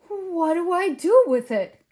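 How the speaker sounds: loud, high outburst of helplessness; crying out. A narrator's reading. Gus finding a baby ghost on the step, crying out. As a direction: call it out high and loud, at a loss. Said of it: "Gus cried".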